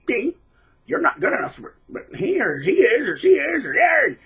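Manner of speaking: high, whiny sing-song taunt; mock taunting narcissist. Mocking the narcissist taunting the old supply. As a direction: sneer it out in a silly high voice.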